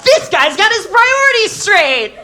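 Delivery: high mock-admiring praise; loud high sarcasm, mocking the guy; sarcastic. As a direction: gush sarcastically about him.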